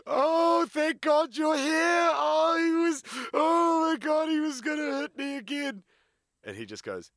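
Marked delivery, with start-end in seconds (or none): 0.0-5.8 very high, loud shrieking fake-tearful plea; fake distressed woman. Scene: abusive girlfriend faking victimhood to the mate, very high loud fake-tearful shriek. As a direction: shriek it like a staged victim putting on tears. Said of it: "She spots him and goes".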